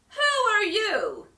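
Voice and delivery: high, loud, slow demand. A narrator's reading. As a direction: demand an answer. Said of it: "demanded the Tin Man".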